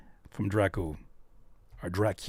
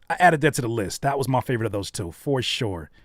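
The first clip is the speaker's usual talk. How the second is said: ordinary talk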